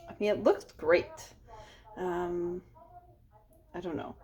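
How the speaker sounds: normal talking voice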